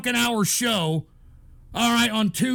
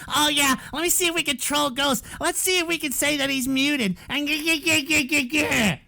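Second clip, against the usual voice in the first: higher, sneering put-on voice ending in stuttered cackle; mocking troll; 5 semitones higher than usual. Streamer imitating chat plotting to troll Ghost, mocking. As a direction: jeer like a gleeful troll. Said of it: "You fucking people are ... saying".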